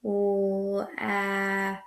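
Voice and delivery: "L, ah," said sound by sound, soft; segmented sounds. Shown as a demonstration. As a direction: isolate each sound softly. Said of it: "Second sound in the word lap".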